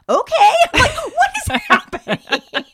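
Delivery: high, loud, laughing disbelief; mock bewilderment. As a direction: re-enact being baffled, voice way up.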